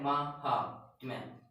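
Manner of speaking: महात्म्य said slowly, each syllable clear; slow demo. Shown as a demonstration. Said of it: "difference देखिए". (महात्म्य को धीरे-धीरे साफ़ बोलिए)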